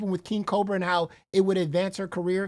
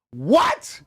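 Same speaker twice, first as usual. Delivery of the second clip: loud, rising burst of disbelief; incredulous; 6 dB louder than usual. Real, not acted. Hearing that matching her up is no issue, loud disbelief. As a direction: blurt it out, astonished.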